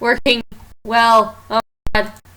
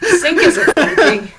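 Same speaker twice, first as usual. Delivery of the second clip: laughing; 5 semitones higher, 6 dB louder, slower than usual. Real, not acted. Complaining tech issue laughing.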